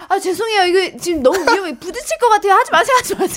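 high-pitched apologetic pleading; mock urgent warning. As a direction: re-enact begging a driver to stop. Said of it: "내가 하면서 너무 웃긴데 아저씨한테". (죄송하다며 다급하게 말리는 흉내)

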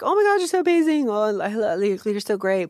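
high, gushing mock praise. Crowd gushing over your outfit, squealing mock praise. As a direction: gush over them in a squealing voice. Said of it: "everybody's like".

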